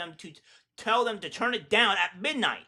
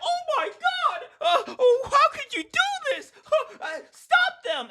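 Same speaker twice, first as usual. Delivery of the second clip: much higher falsetto mock outrage, laughing through it; mocking falsetto of a shocked activist; mock hysterical protester. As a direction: ham up a panicked protester crying foul. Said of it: "They're like".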